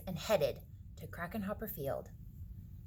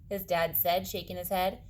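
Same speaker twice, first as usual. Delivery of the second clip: ordinary delivery, nothing marked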